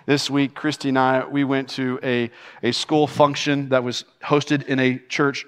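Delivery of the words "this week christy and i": ordinary talk, nothing marked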